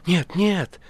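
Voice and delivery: high, loud, agitated denial; urgent exclamation. A narrator's reading. Ivan reassures the guest, urgent exclamation. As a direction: exclaim it hastily and fervently. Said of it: "воскликнул Иван".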